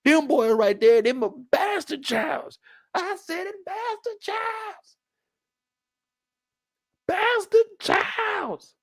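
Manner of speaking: high, drawn-out scornful voice; high mocking old man; indignant old man. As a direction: stretch out bastard childs slowly with contempt. Mocking character insulting grandkids.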